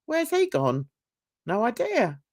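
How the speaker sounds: usual speaking voice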